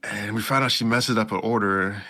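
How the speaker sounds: usual speaking voice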